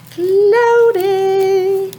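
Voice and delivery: high singing call of Cloudy; playful teacher call; sing-song cloudy. Shown as a demonstration. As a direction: lilt the word Cloudy up high for kids. Teacher sings out weather word.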